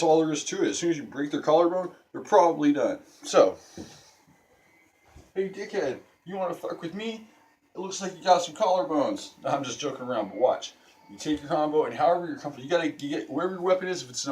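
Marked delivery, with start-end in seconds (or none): 5.3-9.3 high-pitched playful challenge to a fight; mock tough-guy taunt, higher than usual; mock threat. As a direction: pick a pretend fight, pitched well up. Mock confrontation, then says just joking.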